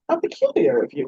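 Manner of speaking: playful mock reproach; teasing. A narrator's reading. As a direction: tease him gently. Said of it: "Regina teased".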